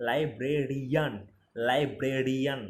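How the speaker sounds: Librarian drawn out, syllable by syllable; slow demonstration. Shown as a demonstration. Teacher models the word for English class, slow demonstration. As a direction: stretch librarian slowly for learners. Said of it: "র এর উচ্চারণটা".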